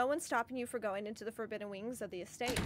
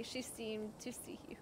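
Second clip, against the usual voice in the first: whisper; 3 semitones lower, 13 dB quieter than usual. A narrator's reading. Reading narration softly.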